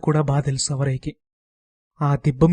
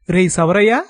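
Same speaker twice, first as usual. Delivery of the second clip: loud call, name rising; 4 semitones higher, 6 dB louder than usual. A narrator's reading. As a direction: shout his name across the yard. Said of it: "అనో కేక". (గట్టిగా పిలిచే కేక)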